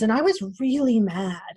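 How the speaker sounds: ordinary talk, nothing marked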